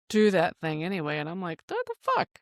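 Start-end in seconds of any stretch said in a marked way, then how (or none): 1.7-2.3 a stunned high-pitched what the fuck; mock disbelief at a defiant four-year-old; shocked outburst. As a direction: jump way up in pitch, incredulous. Mom reacting to defiance.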